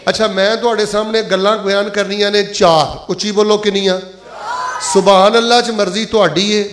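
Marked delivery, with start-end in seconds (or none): none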